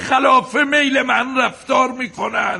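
high, loud, drawn-out protest; angry outburst. Acted. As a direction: shout it in exasperation. Husband lashes out at wife.

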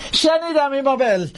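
very high-pitched yell; shouting back. Acted. Jackson answering Imabelle in the panic, high yell. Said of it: "جکسن داد زد".

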